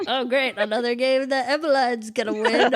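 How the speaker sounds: hammed-up fed-up relatives; mock sarcastic groan. Family complaining about her winning.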